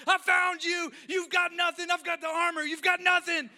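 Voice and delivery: high, full-force triumphant shout; screamed taunt. Retold or imitated. Daughter climbing bunk to fight dad, screamed taunt. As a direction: yell it like a child in battle.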